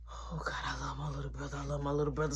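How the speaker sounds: hushed, through-the-teeth sigh of patience; exasperated whisper. Retold or imitated. Big brother whispering to himself, barely holding patience. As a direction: mutter it low so nobody hears. Muttering to self about brother.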